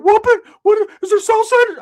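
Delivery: very high, loud put-on voice; startled cousin imitated. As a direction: panic in a squeaky mock yell. Cousin woken up, acted out.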